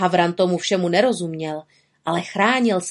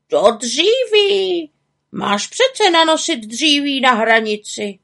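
high, insistent raven voice; impatient high-pitched reminder; nagging raven; 6 semitones higher, 4 dB louder, slower than usual. Acted. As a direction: nag the witch in a raised character voice. Raven nags witch about firewood.

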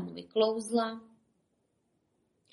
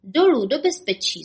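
higher, loud call; 6 semitones higher, 5 dB louder than usual. Acted. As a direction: shout the way down. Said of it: "zvolal Bob".

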